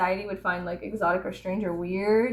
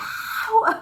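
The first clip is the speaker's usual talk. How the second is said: laughing cry; 13 semitones higher, 4 dB louder than usual. Real, not acted.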